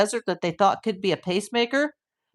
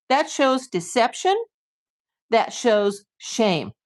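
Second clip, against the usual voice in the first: ordinary talk, nothing marked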